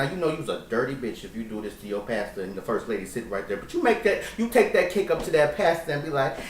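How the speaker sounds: normal talking voice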